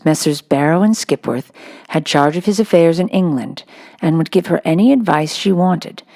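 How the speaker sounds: ordinary delivery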